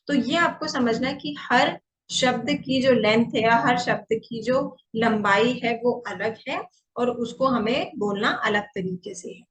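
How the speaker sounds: ordinary delivery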